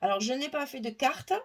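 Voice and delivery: ordinary talk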